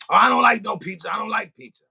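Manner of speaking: mock a hater loudly refusing; pizza hater. Hater turning down offered pizza, high mock protest. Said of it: "you got the hater that, that, that calls up".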